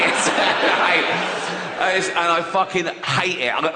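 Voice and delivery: ordinary talk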